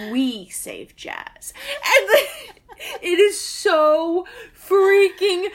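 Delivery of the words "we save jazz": slow deadpan line. Retold or imitated. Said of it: "puffs it out and says".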